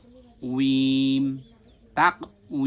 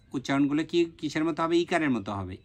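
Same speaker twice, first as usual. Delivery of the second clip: usual speaking voice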